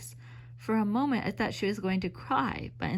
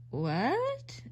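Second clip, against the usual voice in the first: surprised; 7 semitones higher than usual. Acted. Narrator's astonished question.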